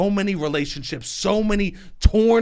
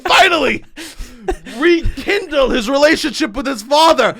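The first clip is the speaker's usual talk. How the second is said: higher, louder, building to a yell; mock rant to a shout; 8 semitones higher, 6 dB louder than usual. Comic ranting to host about fathers and sons, building shout. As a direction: shout the punchline with comic fervour.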